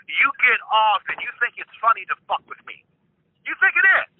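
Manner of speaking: high-pitched, agitated accusation; real heated accusation. Real, not acted. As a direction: accuse them in rising exasperation, voice climbing. [name] accusing the caller of toying.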